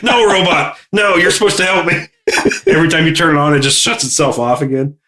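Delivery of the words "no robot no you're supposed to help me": pleading with robot. Retold or imitated.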